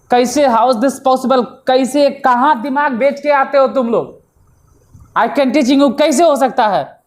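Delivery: teacher venting disbelief at students' mistake; exasperated disbelief. Real, not acted. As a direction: scold the class for a basic error. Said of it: "teacher exasperated at the mistake".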